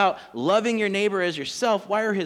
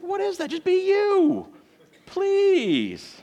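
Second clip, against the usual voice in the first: high, pleading exasperation; much higher exasperated please; 13 semitones higher, much slower than usual. Real, not acted. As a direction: beg them to just be themselves. Pleading hosts to relax.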